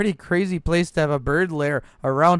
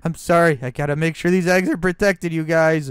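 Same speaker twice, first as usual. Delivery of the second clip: usual speaking voice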